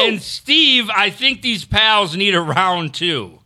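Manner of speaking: slow, high, drawn-out shout-out; hype announcer read. Acted. Reads super chat in hype voice.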